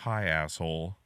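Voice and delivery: low, flat rude greeting; deadpan toddler. Retold or imitated. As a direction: drop the voice for the rude twin. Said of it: "he just goes".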